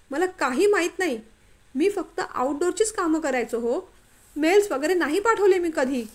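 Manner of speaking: high, loud, desperate denial; shocked, frantic denial; panicked protest. A narrator's reading. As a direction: plead your innocence in a panic. Falsely accused man protests.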